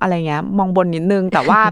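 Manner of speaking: ordinary talk, nothing marked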